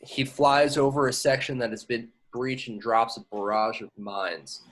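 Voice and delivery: ordinary talk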